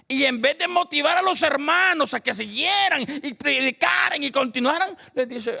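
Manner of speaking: high-pitched, urgent sermon delivery; impassioned preaching. Acted. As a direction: preach it high, building to a loud peak.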